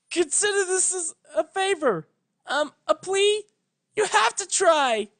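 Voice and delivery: put-on high, pleading young mare's voice; desperate young pony. Twilight begging her old teacher to try. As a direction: beg with rising desperation.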